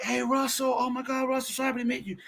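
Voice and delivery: high, gushing mock-excited greeting; ecstatic siblings. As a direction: play the overjoyed kids meeting their big brother. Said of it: "they're very ecstatic".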